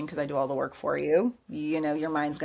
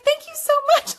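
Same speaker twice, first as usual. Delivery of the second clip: very high, loud, gushing thanks; super bubbly; 8 dB louder than usual. Retold or imitated. Client thanking the sound bath player, mock super bubbly. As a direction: imitate an over-the-top bubbly woman. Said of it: "Still super bubbly".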